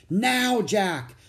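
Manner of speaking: high, loud call; calling out urgently; urgent cry. A narrator's reading. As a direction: cry out to get his attention. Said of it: "cried Annie".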